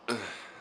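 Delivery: sudden hiccup; a real hiccup. Real, not acted. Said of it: "see, that was one of them".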